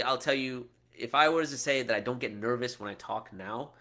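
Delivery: ordinary talk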